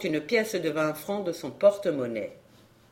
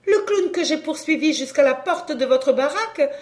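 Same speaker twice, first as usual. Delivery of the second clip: rising, louder, pressing questions; agitated disbelief; 8 semitones higher, 4 dB louder than usual. A narrator's reading. Camille pressing the clown in shocked disbelief. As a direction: press him, stunned and urgent.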